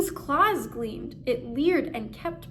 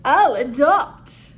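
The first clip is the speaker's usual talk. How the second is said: cackling; 10 dB louder, slower than usual. Acted. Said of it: "and cackled".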